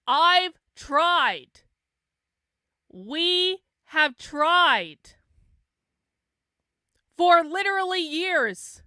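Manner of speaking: loud, high, slow and emphatic; exasperated yelling. Real, not acted. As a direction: shout each phrase with long pauses, fed up. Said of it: "Why are you yelling?".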